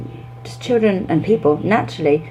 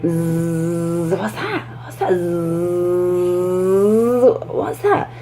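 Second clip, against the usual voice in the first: buzzing then a curious what's that; teacher acting out a bee for the class; buzzing bee game; much slower than usual. Shown as a demonstration. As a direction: buzz like a bee, then wonder aloud. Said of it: "you're like".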